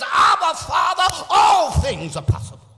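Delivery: loud, high fervent cry of Abba, Father; Jesus praying aloud. Acted. Jesus in Gethsemane crying out to his Father. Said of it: "he says".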